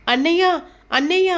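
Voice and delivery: high, loud calling out. A narrator's reading. Meera calling her brother loudly for help. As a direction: call him from across the house. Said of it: "గట్టిగా పిలుస్తుండగా".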